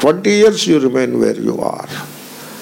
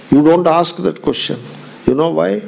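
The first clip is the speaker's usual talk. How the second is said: usual speaking voice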